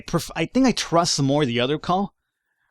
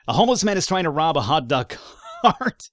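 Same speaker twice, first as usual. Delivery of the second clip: breaking into laughter at the end; laughing; 3 semitones higher than usual. Real, not acted. Player reading an absurd police call, cracking up laughing. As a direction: read it out, then crack up on the last word. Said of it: "I'm laughing".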